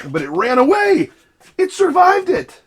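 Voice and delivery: high and loud, "It survived it" slowed down; amazed that the spider got away; surprised disbelief. Real, not acted. Spider escaped spray.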